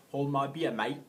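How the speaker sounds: loud, hammed-up Australian surfer; Australian accent, imitated lightly; mock Australian. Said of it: "He's Australian".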